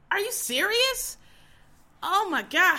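high, stretched-out disbelief, slowed right down; incredulous outrage. Real, not acted. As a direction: squeal it like you can't believe your eyes. Outraged at fast food dinner.

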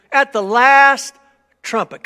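call and response. Shown as a demonstration. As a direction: belt it out for them to echo. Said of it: "Say it with me".

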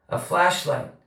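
high, faint voice from far off; distant boy. A narrator's reading. Charlie under the house to Lonnie, distant and faint. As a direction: call back softly as if from a crawl space.

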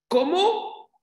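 sudden very high, loud cry of disbelief; daughter's anguished cry. Retold or imitated. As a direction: shriek it like a distressed daughter. Daughter's anguished question quoted. (grito agudo de hija angustiada)

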